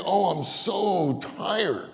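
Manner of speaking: high, drawn-out tired groan of words; weary complaint out loud. Acted. Preacher mimics tired churchgoer in a meeting, weary complaint. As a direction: complain aloud, stretching it slowly. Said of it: "You don't say it out loud".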